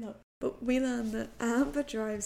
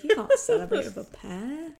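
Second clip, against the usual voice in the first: loud, higher mock father scolding; mock scolding dad; 5 semitones higher, 10 dB louder than usual. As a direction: ham up a bossy father. Voicing Spencer's dad scolding.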